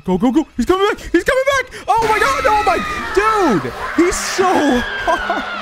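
high, frantic shouts; panicked yelling. Real, not acted. Player fleeing as he's coming back, panicked. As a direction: shriek it in a real panic. Chased by the game's enemy.

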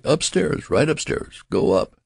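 high, panicky directions; hysterical. A narrator's reading. Terrified nurse directing the father upstairs, hysterically. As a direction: send him off in a high, hysterical flurry. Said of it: "she cried hysterically".

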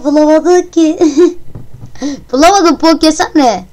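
high put-on teasing voice; mock voice. Player teasing the game that it couldn't guess, mock high voice. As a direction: taunt the game in a silly voice. Mocking the game's failure.

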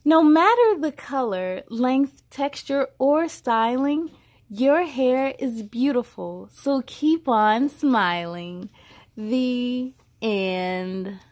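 put-on bright, measured read-aloud voice for a child; storybook reading. A narrator's reading. Reading a hair alphabet book aloud to a child, storybook voice. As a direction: read each line out like a picture book.

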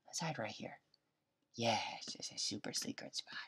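soft unvoiced whisper. Real, not acted. Gamer whispering while hiding in a secret spot. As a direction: whisper so nobody finds you. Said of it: "I don't know why I'm whispering".

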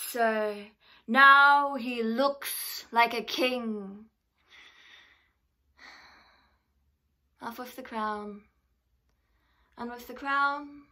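theatrical scorn with big loud swells and drops; mock a captive rival, stretching the key words; grand, slow stage declamation; taunting Shakespearean royal. Performs Shakespeare monologue after introduction.

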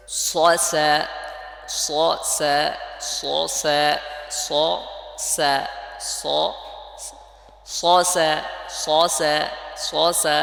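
loud rounded heavy স then thin, open স্যা; pronunciation drill. Shown as a demonstration. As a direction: alternate স and স্যা in emphatic separate syllables. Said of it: "এবার".

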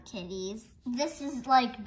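ordinary talk

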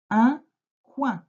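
high, loud, careful dictation. Shown as a demonstration. Teacher dictating to a pupil, slow and clear. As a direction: call the word out clearly for the class.

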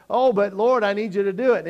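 a woman begging Jesus, loud and pleading. Acted. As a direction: beg him loudly for help.

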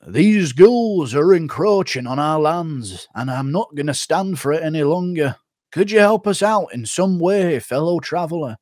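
NPC quest giver. Pretend. Streamer voicing a game villager asking a traveler for help. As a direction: plead like a game villager, drawn out. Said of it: "N P C from Skyrim or The Witcher".

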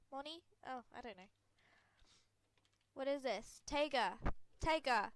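normal talking voice

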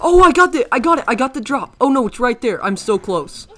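high, loud, fast gasping excitement; excited. Real, not acted. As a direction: blurt it out thrilled at the loot. Rare item drops in game.

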